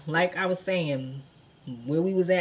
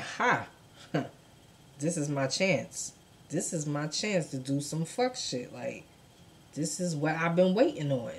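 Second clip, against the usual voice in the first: sly scheming; 2 semitones lower, 6 dB quieter than usual. Retold or imitated. Fisher scheming quietly.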